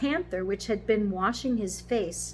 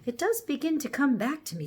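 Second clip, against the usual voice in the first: higher-pitched, musing horse; thoughtful; 7 semitones higher, 3 dB louder than usual. A narrator's reading. Horse starting to remember his old master, thoughtful. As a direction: let the memory dawn on you. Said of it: "said the horse thoughtfully".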